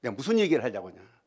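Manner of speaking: usual speaking voice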